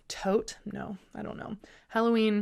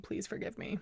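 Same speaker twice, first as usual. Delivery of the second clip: whispered; 11 dB quieter than usual. Real, not acted. Sheepish apology after vegan repetition.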